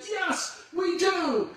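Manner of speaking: high, thin cheer of a crowd; be the whole crowd shouting back. Retold or imitated. Crowd cheering back at the tightrope walker, high. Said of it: "And they said".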